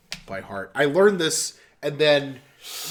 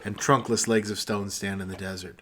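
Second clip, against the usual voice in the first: solemn recital through a laugh; poem recitation; 2 semitones lower, 5 dB louder than usual. Acted. Reciting the poem again while friends heckle. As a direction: recite it gravely, fighting a giggle.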